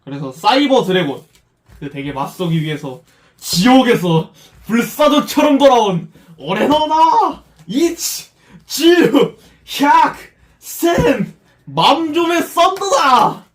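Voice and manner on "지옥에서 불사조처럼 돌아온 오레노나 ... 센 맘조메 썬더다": slow, high dramatic declaration; anime villain. Acted. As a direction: announce yourself like an anime duelist. Dramatic anime duelist entrance.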